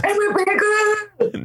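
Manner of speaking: put-on high, drawn-out squeaky little kid voice; little boy's voice. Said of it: "That's how he, his little voice".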